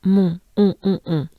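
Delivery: "Mon, on, on, on" with a very nasal "on"; nasal French vowel. Shown as a demonstration. Teacher drills the French "on" sound, very nasal. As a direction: make every "on" in "Mon, on, on, on" very nasal. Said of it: "It's very nasal".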